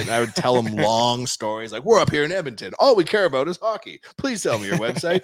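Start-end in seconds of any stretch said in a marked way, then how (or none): 1.8-5.2 high, earnest-sounding re-enacted plea; mock pleading. Founder begging the owner to sell his website, mock pleading. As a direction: beg in a raised, eager voice.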